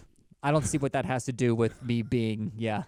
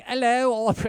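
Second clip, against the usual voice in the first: high, loud British "Hello"; a quick posh British greeting; hammed-up British accent; mock British hello; 11 semitones higher, 6 dB louder than usual. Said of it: "no way I'd be able to be like".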